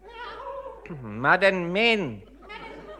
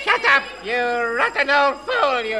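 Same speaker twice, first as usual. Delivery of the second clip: angry shout; 8 semitones higher than usual. Acted. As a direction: yell it at her in a rage. Furious husband yells at wife.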